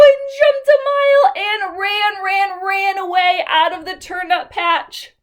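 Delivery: high, loud rush; storyteller telling the goat running away; excited narration. A narrator's reading. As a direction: tell the escape breathlessly.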